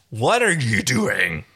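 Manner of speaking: lightly voiced character, slow question; a touch of slow, curious character voice; Pilot. A narrator's reading. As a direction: draw the question out slowly. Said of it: "It's Pilot".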